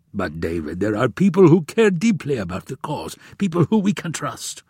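insistent royal benefactor, voice lifting; Omar. Acted. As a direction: plead the case as the prince, pitch climbing.

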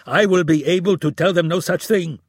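loud, high furious yell. A narrator's reading. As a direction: shout it at him across the desk. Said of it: "he yelled".

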